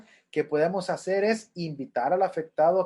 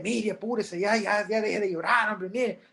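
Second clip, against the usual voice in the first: higher mock hurry-up; impatient comforter; 4 semitones higher than usual. Careless friend pushes a crying widow to hurry, mocked higher. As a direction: rush the mourner, half-mocking. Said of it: "En lugar de decirle".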